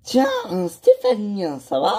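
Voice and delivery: happy surprise at seeing a friend again; surprised greeting. Acted. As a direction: react to a returning friend. Surprised to see a friend return.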